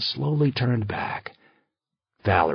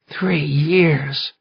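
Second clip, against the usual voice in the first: raspy with grief; 5 semitones higher, 5 dB louder than usual. Acted. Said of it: "her voice raspy with grief".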